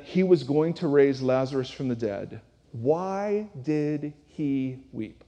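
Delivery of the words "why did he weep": normal talking voice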